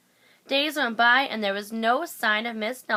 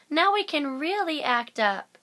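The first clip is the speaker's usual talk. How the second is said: put-on gleeful kids; 6 semitones higher than usual. A narrator's reading. Kids gleeful at teacher's absence.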